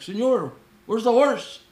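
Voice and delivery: high, loud mock Mexican accent; Mexican captain accent. Boat captain asking about horse, Mexican accent. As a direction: imitate a Mexican captain calling out.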